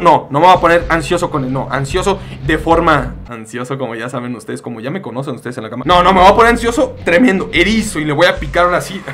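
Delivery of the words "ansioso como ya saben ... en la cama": suggestive aside. Pretend. Innuendo aside to viewers.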